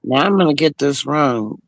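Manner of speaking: ordinary talk, nothing marked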